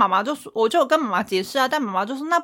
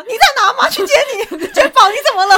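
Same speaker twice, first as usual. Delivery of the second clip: high, loud anxious mother calling out; fussing worried mom; 13 semitones higher, 8 dB louder, faster than usual. Pretend. Mocking her future self as a worried mother, high and loud. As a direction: sound like a mom panicking over her kid.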